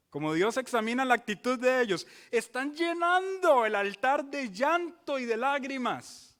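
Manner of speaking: loud, high, pounding each phrase; fiery pulpit preaching; preaching at full force. Acted. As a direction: hammer the image of tears on the altar.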